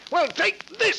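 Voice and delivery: Henry striking Albert in rage; furious shout. Acted. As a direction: lash out with the blow. Stabbing his friend in rage.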